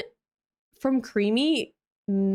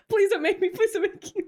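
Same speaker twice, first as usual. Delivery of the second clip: mock pleading; 10 semitones higher, faster than usual. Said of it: "I'm scared".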